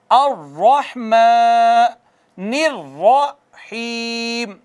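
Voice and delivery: अर्रहमा and रहीम with thick रा, drawn out; heavy ra, slow. Shown as a demonstration. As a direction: recite slowly with a heavy रा. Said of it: "रा मोटी पड़ी जाएगी". (मोटी रा, धीमी तिलावत)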